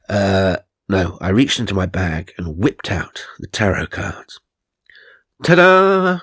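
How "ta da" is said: loud, much higher fanfare; theatrical ta-da, loud and high; showy ta-da. Retold or imitated. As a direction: present it like a magic trick. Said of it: "I said".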